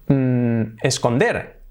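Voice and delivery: ordinary delivery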